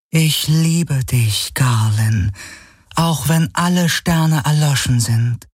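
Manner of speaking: high, slow, hushed declaration; loving shadow apparition. Acted. Illusion declares love to Galen, tender and devoted. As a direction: confess love in a drawn-out high voice.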